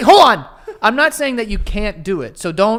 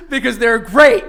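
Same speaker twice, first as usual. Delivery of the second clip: laughing; 5 semitones higher, 6 dB louder, slower than usual. Real, not acted. Bursts out laughing.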